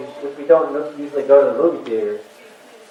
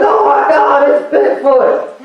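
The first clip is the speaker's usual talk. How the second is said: gushing fan; 11 semitones higher, 4 dB louder, slower than usual. Pretend. Fans gushing over Bigfoot boyfriend.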